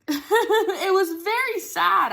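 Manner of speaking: laughing through the words it was very sad; a real laugh breaking over a sad memory. Real, not acted. Laughing while recalling sad moment.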